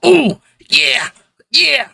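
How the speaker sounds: put-on high-pitched kid grooving to himself; little dancing yelps. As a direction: let out small piping dance exclamations. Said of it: "you just hear a little".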